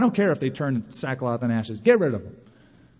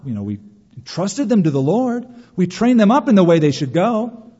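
normal talking voice

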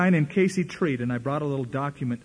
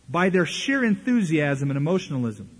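ordinary talk

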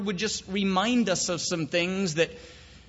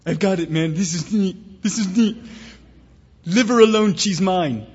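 put-on an eager, accented little dog voice, louder on the punchline; chihuahua voice; 4 dB louder than usual. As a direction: play the chihuahua with a comic accent, proud of his joke.

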